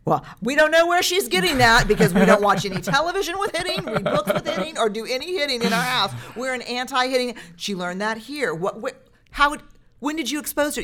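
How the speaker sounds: high, laughing, flustered self-mockery; defensive mocking parent. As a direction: play a frantic defensive parent. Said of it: "your own shame stuff".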